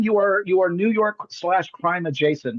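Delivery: ordinary delivery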